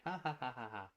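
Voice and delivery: soft, spoken-out fake laugh; mock open laugh; imitated laugh. Shown as a demonstration. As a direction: demonstrate a laugh with deliberate ha-has. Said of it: "and an open laugh".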